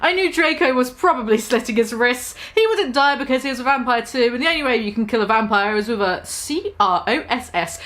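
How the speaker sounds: ordinary talk, nothing marked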